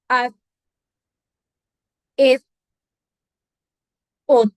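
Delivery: आ, ए, ओ each cut off dead at the end, no bounce; stopped without echo. Shown as a demonstration. Teacher showing letters read without qalqala. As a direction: stop the sound of ए instantly, no movement. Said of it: "फौरन मैं रुक गई हूँ".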